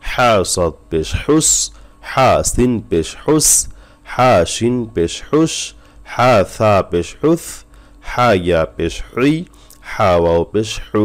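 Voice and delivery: slow spelling drill with হা from the throat; model spelling recitation. Shown as a demonstration. Teacher reads the line for students to copy, slow throaty হা. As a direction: sound হা from the throat, drawing out each final হুস. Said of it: "আমি পড়তেছি, খেয়াল করেন".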